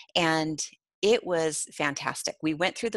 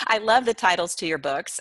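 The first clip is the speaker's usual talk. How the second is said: ordinary delivery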